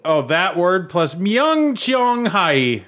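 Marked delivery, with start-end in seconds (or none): 1.2-2.8 mock Korean accent. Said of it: "now you're definitely just being racist".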